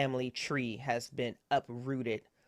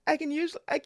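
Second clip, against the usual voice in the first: much higher, louder, frantic apology; panicked high voice; 6 dB louder, faster than usual. Acted. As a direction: babble apologies in rising panic. NPC mother panicking, apologizing.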